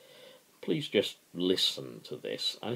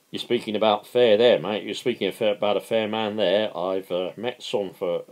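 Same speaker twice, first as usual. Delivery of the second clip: mocking workmate; 5 semitones higher, 9 dB louder than usual. Workmate Harlow mocking Owen.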